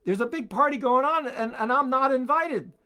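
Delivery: retelling Frog griping he wasn't invited; indignant Frog. Retold or imitated. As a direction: sound miffed at being left out of the party. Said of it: "Frog calls him up and says".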